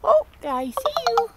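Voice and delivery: high, loud, thrilled; delighted discovery. Real, not acted. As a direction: burst out with delight at finding it. Spots the bird suddenly.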